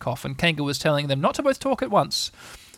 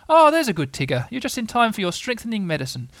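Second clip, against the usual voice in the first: higher-pitched Kanga, pleased with Tigger; motherly Kanga; 7 semitones higher than usual. Acted. Kanga to Tigger at medicine time, motherly and high. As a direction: voice a mother greeting her good Tigger, pitched up.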